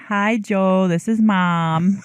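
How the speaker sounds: lightly voiced mother, slow and loud; a hint of Mom calling; mom's voice. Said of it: "in my mom's voice".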